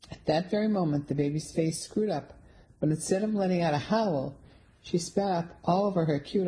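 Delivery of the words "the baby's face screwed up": ordinary delivery, nothing marked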